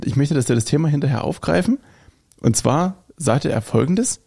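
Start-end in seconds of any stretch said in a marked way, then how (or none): none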